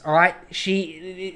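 usual speaking voice

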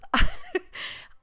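sudden high, loud laugh; real high-pitched laugh; high squealing laugh. Real, not acted. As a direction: shriek with laughter. Laughs at ironic sign-off.

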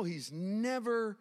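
usual speaking voice

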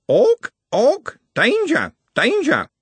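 high, screeched alarm calls; bird-like shriek, each word repeated; squawking bird creature. Acted. The sisters warn of an orc, squawking alarm.

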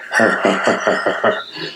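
rattling stone laugh like shaken gravel; gravelly laugh. A narrator's reading. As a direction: laugh like loose gravel in a bag. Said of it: "a sound like someone shaking a bag of loose gravel".

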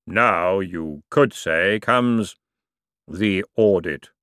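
slow, grave voice of Death. Acted. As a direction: let every word fall slowly and solemnly. Death pronounces the audit.

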